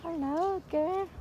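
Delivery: softly cooing a greeting to a stray; pet voice. Real, not acted. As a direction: coo softly to the dog. Greeting a dog.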